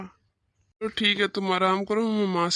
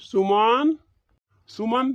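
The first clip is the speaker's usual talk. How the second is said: raised voice with a rising end; calling out; 3 semitones higher, 5 dB louder, much slower than usual. Acted. Husband calls to his lost-in-thought wife, calling out. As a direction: call out to get her attention. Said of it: "कब से आवाज लगा रहा था".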